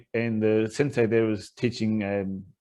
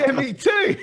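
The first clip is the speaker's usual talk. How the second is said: very high, squeaky agreement; hammed-up, high-pitched outburst of shared alarm; mock agitation; 14 semitones higher than usual. Student answering the Canadian guy, mock agitation. Acts his own agitated reply.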